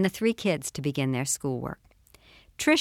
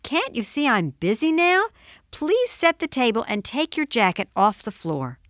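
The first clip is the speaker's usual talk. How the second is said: high, harried mom voice; acted exasperation, pitched up; exasperated mother; 8 semitones higher, 3 dB louder than usual. A narrator's reading. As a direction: scold a child while busy with dinner. Overwhelmed mother scolds her son.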